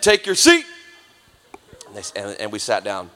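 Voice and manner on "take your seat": loud, high barked order; drill sergeant command. Retold or imitated. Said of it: "In the military, they said".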